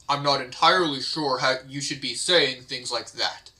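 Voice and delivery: normal talking voice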